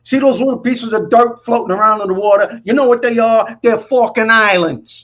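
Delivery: Brooklyn insult. Retold or imitated. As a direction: snap at him like a Brooklyn wise guy. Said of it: "My friend goes".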